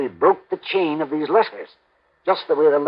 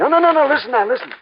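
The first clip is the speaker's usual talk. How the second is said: higher, pressing rush of no's; urgent insisting; 7 semitones higher, faster than usual. Acted. Worried client urgently stopping the investigator's dismissal. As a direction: cut him off, desperate to be heard.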